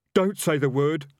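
terrified highwayman pleading. A narrator's reading. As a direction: beg him quickly. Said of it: "even more urgently".

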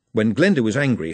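plain reading voice

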